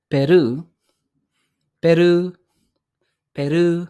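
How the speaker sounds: Peru with the final u held long; long final vowel. Shown as a demonstration. Teacher modelling the long vowel for learners. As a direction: stretch the end of Peru. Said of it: "meron siyang dash sa dulo dahil prolong yung sound".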